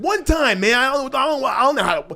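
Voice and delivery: high, stumbling, laughing panic; frantic pleading. Retold or imitated. Begging his old cellmate, stammering, high and frantic. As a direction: stammer it out, desperate and amused.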